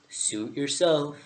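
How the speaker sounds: slow, drawn-out caterpillar brush-off. Acted. Vaping caterpillar gives up on the girl, slow caterpillar voice. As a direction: let it drag out, unbothered.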